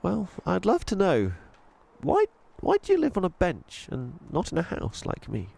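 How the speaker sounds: put-on high, curious little-girl voice; young girl Chloe.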